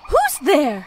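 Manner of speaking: sudden loud shout of alarm; scream. A narrator's reading. Ostrich screaming at a hidden watcher, loud. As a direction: shout it into the dark. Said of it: "suddenly she screamed".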